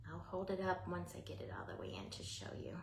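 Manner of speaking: whispering. Real, not acted. Whispering while working.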